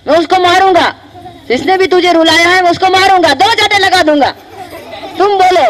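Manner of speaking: angry threat. Acted. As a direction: vow to beat up the culprit. Bhim threatens whoever made mother cry.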